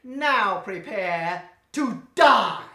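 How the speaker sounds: slow, loud villain threat; menacing villain. Acted. Villain telling Santo Junior to prepare to die, slow menace. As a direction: draw out the threat, gloating.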